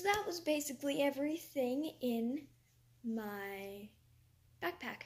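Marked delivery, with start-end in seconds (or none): none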